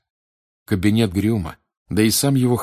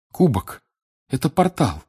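put-on higher, agitated boy, halting phrases; измученный мальчик; 7 semitones higher, 3 dB louder than usual. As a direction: blurt it out in distress. (взволнованный, сбивчивый мальчишеский голос)

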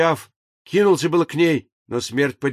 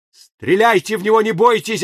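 loud, higher desperate call; desperate shout; 6 semitones higher, 4 dB louder than usual. A narrator's reading. The pinned engineer begs Death to shoot, desperate loud shout. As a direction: urge her to fire, desperately. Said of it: "отчаянно крикнул".

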